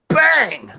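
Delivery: high, sharp "Bang!" like a punch landing; mock bang sound effect. Friend retelling a porch fight, punchy bang sound. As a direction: smack the sound out for the impact.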